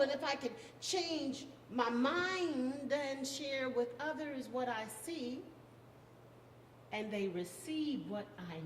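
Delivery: spoken-word poem with swelling rises and falling line ends; poetry recitation. Acted. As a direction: perform it as a rousing poem, with weighted pauses.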